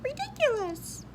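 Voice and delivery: high scornful bird voice; high tweeting scorn. Acted. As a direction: sneer like a little bird. Bird character mocking.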